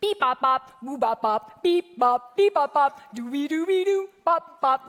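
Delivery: bouncy mimicked baby babble; baby jabbering. Pastor mimicking his baby nephew's babble. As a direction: babble like a one-year-old in a car seat. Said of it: "just jabbering nonsense".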